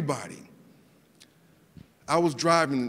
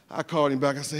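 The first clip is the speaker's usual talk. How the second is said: normal talking voice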